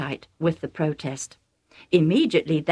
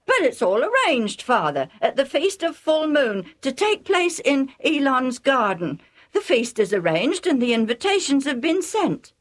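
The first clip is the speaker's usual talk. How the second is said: a hint of a higher, pleading son; lightly voiced Esau; 9 semitones higher than usual. A narrator's reading. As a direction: protest mildly, a touch higher. Esau answers his father.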